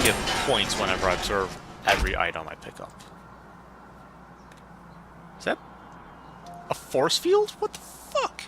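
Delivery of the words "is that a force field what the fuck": shocked high-pitched squeal; startled. Real, not acted. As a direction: jump at it in shock. Startled by game.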